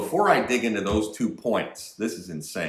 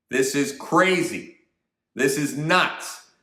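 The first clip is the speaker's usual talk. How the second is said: outraged; 6 semitones higher, 4 dB louder, slower than usual. Real, not acted. Outraged commentary.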